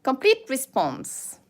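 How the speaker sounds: put-on slow careful Japanese-accented English; mock Japanese accent. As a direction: say it like a Japanese announcement. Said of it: "do a good accent".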